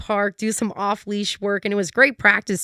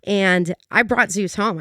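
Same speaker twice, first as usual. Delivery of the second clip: normal talking voice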